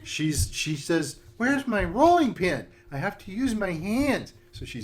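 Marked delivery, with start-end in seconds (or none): 1.4-4.3 witch voice. Acted.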